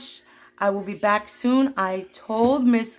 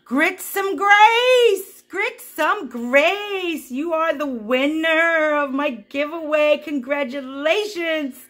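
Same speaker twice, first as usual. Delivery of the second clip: much higher and louder, slow drawn-out reveal; big announcement; 6 semitones higher, 4 dB louder, slower than usual. Real, not acted. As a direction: stretch out the name like a game-show host. Announcing the giveaway winner.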